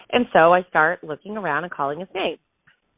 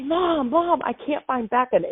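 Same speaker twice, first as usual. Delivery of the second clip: retold panic; 8 semitones higher than usual. Daughter waking her mom over the missing child, panicked. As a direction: re-enact a frantic plea for help. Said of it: "I am in a total full-fledged panic now".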